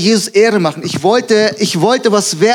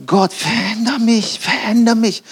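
flehendes Gebet; 3 semitones higher than usual. Retold or imitated. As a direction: stretch the words out, imploring. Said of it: "Und ich sag". (gedehntes, flehendes Gebet)

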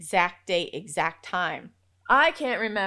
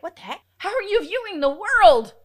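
high-pitched incredulous exclamation; comic, squealed amazement; astonished; 10 semitones higher, 12 dB louder than usual. Retold or imitated. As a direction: build from startled to loud disbelief. Retold astonishment at workshop.

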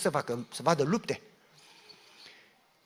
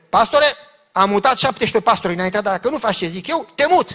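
loud threat; 2 semitones higher, 10 dB louder than usual. Retold or imitated. Church boss threatening the new pastor, loud and domineering. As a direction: bark the threat down at him.

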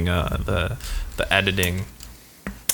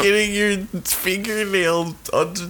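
being tickled; 7 semitones higher, 3 dB quieter, slower than usual. Real, not acted. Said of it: "dirt under your fingernails on my feet".

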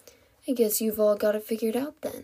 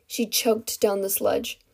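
plain reading voice

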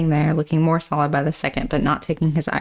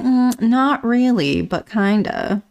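sheepish hedge; 6 semitones higher, slower than usual. Acted. Hel's sheepish hedging reply.

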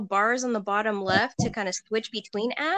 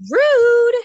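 much higher, louder indignant "rude"; huffy mock offense; 11 dB louder than usual. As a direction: toss it off, scandalized and theatrical. Reading Slytherin's huffy line.

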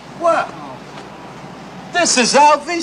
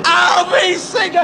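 excited shout across the street; calling out; 5 semitones higher than usual. Real, not acted. Fan alerting friends to the TV star, shouting.

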